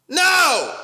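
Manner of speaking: high, loud, exasperated no; emphatic shout. Real, not acted. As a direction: shout the denial high and hard. Emphatic answer to own question.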